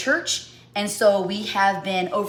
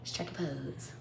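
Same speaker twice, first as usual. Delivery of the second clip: hushed repeat; 7 semitones lower, 15 dB quieter, slower than usual. Acted. Vogue repeat.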